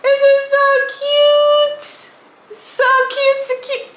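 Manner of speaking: high delighted squeals, drawn out; excited shrieking. Real, not acted. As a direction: squeal over something adorable. Overjoyed at cute gift.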